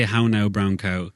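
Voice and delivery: slow, rounded Irish country vowels; Irish country accent. Shown as a demonstration. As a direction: say it like a rural Irish speaker. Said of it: "every vowel would have an ooh".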